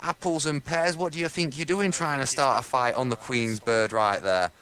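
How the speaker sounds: high, hammed-up English bobby; English policeman. English policeman telling off a lad for fighting swans, hammed-up. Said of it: "those English policemen with the bear hats".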